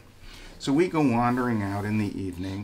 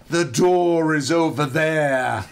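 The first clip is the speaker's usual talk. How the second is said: put-on slow, louder Irish-accented line; 3 semitones higher, 6 dB louder, slower than usual. Irish woman telling the intruder where the door is, Irish accent. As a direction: point the way in a lilting Irish accent. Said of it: "some Irish, I think she was Irish".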